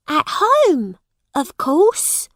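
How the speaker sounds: put-on high, loud girls' reply; surprised sisters. A narrator's reading. Sisters answering their bewildered brother, surprised. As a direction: answer high, as if it were obvious.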